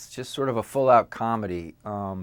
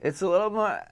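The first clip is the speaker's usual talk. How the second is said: put-on Valley Girl voice; 9 semitones higher than usual. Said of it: "does a really good Valley Girl voice".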